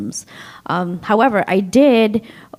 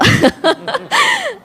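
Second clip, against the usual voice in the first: high bright laugh; short laugh; 4 dB louder than usual. Real, not acted. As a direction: let out a quick laugh. Laughs about not being planned.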